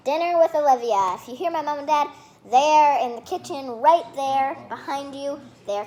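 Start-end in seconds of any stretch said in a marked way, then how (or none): 0.1-1.2 bright loud call of the book title; loud title announcement. Real, not acted. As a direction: announce the title proudly to the camera.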